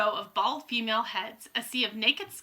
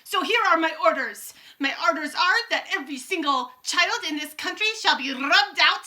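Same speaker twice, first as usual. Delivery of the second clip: commanding witch; 6 semitones higher, 6 dB louder than usual. A narrator's reading. Witch issuing orders.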